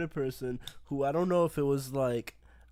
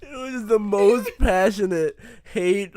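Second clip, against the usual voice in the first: laughing; 7 dB louder than usual. Real, not acted. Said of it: "there was so much energy in it".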